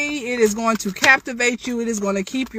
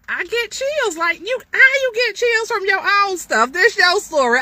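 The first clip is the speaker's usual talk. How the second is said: much higher, bubbling with excitement; thrilled; 12 semitones higher, 4 dB louder than usual. Real, not acted. Author thrilled by her own story, excited. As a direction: gush about getting chills.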